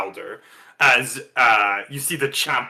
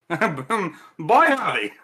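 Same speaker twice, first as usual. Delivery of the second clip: ordinary talk, nothing marked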